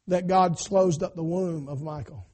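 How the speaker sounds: ordinary talk, nothing marked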